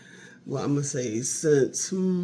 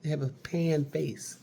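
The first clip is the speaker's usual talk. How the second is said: ordinary talk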